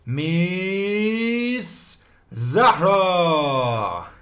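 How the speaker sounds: slow, drawn-out stage-host introduction; mock announcer. Teacher hypes up his student like a stage host, slow mock announcer. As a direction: present her like a talent-show compère, playfully.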